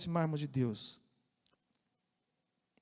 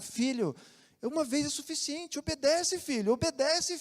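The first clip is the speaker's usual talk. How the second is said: put-on higher, pleading father pressing his child to obey; insistent father; 6 semitones higher than usual. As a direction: stretch each Obedece upward like a tired dad. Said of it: "permitam usar esse exemplo".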